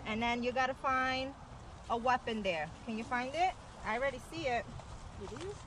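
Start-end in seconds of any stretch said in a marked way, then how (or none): none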